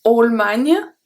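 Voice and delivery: Allemagne as ol-man-ya, rising; mock learner's Allemagne; wrong pronunciation. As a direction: imitate the mistaken ol-manya. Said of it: "I heard".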